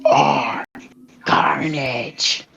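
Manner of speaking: slow, scratchy villain voice; scratchy, stretched-out symbiote declaration; Carnage. Acted. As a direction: draw out each word in a scratchy monster voice. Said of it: "My voice is scratchy".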